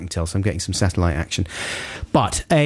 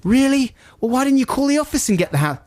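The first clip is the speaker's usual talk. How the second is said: much higher, tight irritated complaint; reliving the phone row, voice climbing; retold anger. Scolding the installer in high retold irritation. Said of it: "I'm getting really angry now".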